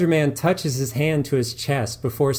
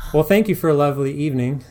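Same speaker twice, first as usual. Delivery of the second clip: fast, louder, smug thanks; a smirk you can hear; hurried smug goodbye; smirking farewell; 4 semitones higher, 6 dB louder, faster than usual. Acted. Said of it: "says Niles, a smirk growing".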